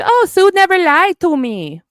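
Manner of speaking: high, mocking whine; mocking Andy. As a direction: sneer his words back at him.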